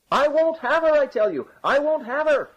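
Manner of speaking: very high-pitched wail of refusal; loud protest. A narrator's reading. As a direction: cry it out to be overheard. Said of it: "as loud as he could".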